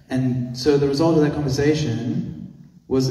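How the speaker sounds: usual speaking voice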